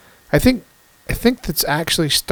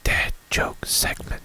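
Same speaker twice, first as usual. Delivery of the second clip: hushed dramatic whisper; 10 dB quieter, slower than usual. Pretend. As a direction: announce it in a secret whisper. Dramatic announcement of segment.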